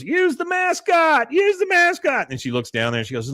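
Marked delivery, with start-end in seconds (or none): 0.0-2.3 very high, loud squawked quote; mock Peggy shout. Peggy nagging Hank from the stands, high mock shout. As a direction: holler it way up high.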